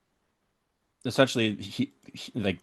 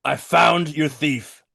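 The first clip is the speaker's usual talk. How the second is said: slow, raised public announcement; oratory; 4 semitones higher, slower than usual. Acted. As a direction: address the whole bar grandly. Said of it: "please do oratory now".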